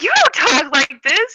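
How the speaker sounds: high, loud imitated protest; mock incredulous. Imitates game master objecting, incredulous.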